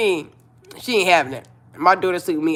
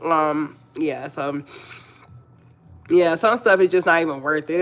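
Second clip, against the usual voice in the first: ordinary talk, nothing marked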